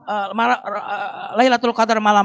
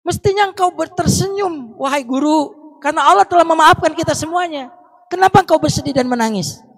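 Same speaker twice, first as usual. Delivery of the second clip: pleading student; 8 semitones higher than usual. Retold or imitated. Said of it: "Muridnya bilang".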